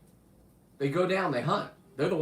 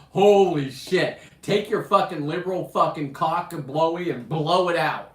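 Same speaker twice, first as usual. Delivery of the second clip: loud rant; 4 semitones higher, 6 dB louder than usual. Real, not acted. Ranting at liberals on stream.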